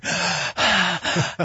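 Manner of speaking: a sharp, high gasp for air. Shown as a demonstration. Demonstrating gasping for air to listener, sharp high gasp. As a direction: show what gasping for breath sounds like. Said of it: "trouble breathing is like gasping for air".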